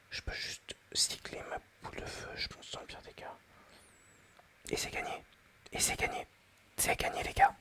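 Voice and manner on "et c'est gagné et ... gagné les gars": triumphant whisper. Real, not acted. Winning the match.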